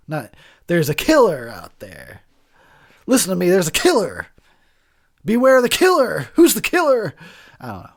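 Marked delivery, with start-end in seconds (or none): 0.7-4.3 mock movie warning. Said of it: "it's not normal speak". 5.2-7.1 mock dramatic, louder and higher; hammed-up horror warning. Mocking the movie's dialogue, hammed-up louder warning. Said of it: "it's not normal speak".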